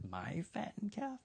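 soft, rising disbelief; incredulous. Acted. Older brother learns his calf was killed, incredulous. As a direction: question it in a small, high, stunned voice.

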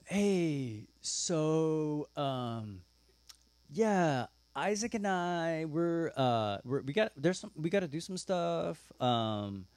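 hesitant, drawn-out evasive excuse; awkward stalling. Acted. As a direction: feel your way through it, stretching the fillers. Abraham awkwardly stalling with his servants.

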